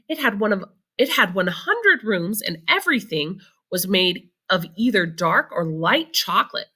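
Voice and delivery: plain reading voice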